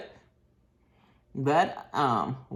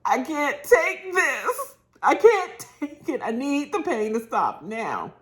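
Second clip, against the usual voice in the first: high, slow, whining mock plea; hammed-up moan of a sick husband; whiny husband imitation; 9 semitones higher, 3 dB louder than usual. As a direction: stretch it out like a man at his limit. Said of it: "and he says".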